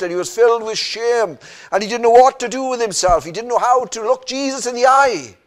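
full-force preaching. Acted. Preacher driving home Peter's denial, full force. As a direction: preach it hard to the pews.

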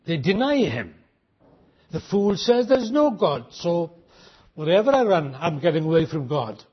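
ordinary talk

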